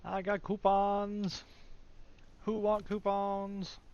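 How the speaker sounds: high, slow goofy hawker voice; drawn-out playful peddler call; silly sing-song voice. Pretend. Hawking coupons in a silly voice.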